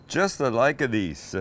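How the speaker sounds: playful mock Italian accent. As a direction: put on a hammy Italian accent. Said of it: "I'm doing that Italian accent".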